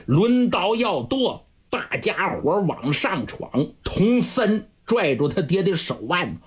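usual speaking voice

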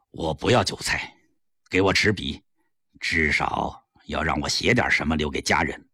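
very low, subdued request; grave resignation. A narrator's reading. Condemned prisoner asks to write to family, resigned.

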